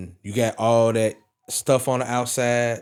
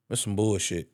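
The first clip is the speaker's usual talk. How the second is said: low, soft, fed-up grumble under the breath; muttered disgust; 5 semitones lower, 7 dB quieter than usual. Retold or imitated. Wrestling fan to his wife, quietly disgusted. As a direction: mutter it to yourself, let down. Retelling disappointment Roman passed by.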